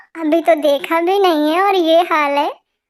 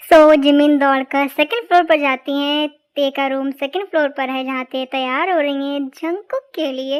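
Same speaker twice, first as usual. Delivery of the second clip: softer narrator voice, reading the story aloud; story narration; 2 semitones lower, 4 dB quieter than usual. A narrator's reading. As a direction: step out of the scene and narrate calmly. Narrator tells story between voices. (धीमी आवाज़ में कहानी सुनाना)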